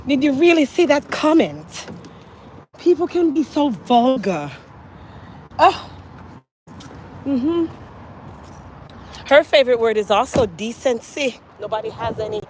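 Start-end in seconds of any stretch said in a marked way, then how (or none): 0.1-7.7 higher, mock-appalled refined friend; Grace. Imitating her friend on a phone call, mock scandalized. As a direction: lightly put on a scandalized proper voice. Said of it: "when she calls me, she's like".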